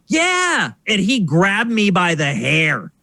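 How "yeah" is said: put-on innocent kid. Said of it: "And I was like".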